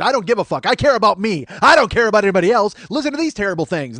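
loud, high self-centred rant; acted angry outburst. Comic arguing with his consolers, angry outburst. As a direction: shout them down in mock outrage.

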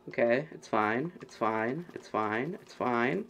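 ordinary talk, nothing marked

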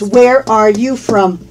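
slow, measured teacher's question; deliberate, careful "Where are you from"; slow model. Shown as a demonstration. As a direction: space out each word for learners. Slow model before natural-speed repeat.

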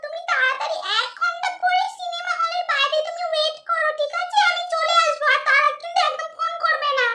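put-on high, soft sugary young girl; whispery young girl plotting a date; girly little sister voice. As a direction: coo sweetly to her sweetheart on the phone.

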